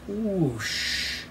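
high, soft falling whoosh; imitated bomb whistling down; long whoosh, sliding downward. A narrator's reading. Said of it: "first bomb whistled through the air".

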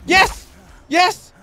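high-pitched victory cries; triumphant cheer. Real, not acted. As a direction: celebrate the kill in short bursts. Kills the badger.

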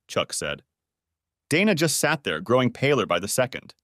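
ordinary delivery

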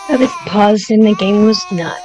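loud, exasperated complaint at the game; frustrated outburst. Real, not acted. Gamer annoyed the game kept running, loud complaint. Game ran on while paused.

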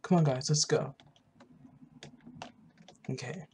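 ordinary talk, nothing marked

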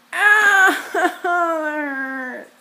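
high cry, laughing through pain. Real, not acted. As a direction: laugh and wince at once. Clothespin pinches face.

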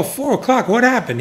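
high-pitched mild disbelief; retelling a startled reply, pitch jumping up; surprised question. Retold or imitated. Teller replays asking his friend about the early start, mildly surprised.

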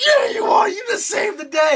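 high excited shout of approval; cheering fan. Retold or imitated. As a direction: cheer the hero on at high pitch. Acts out cheering viewer.

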